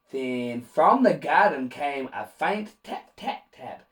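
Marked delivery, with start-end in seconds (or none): none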